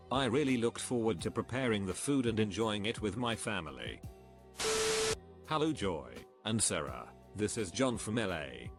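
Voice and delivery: usual speaking voice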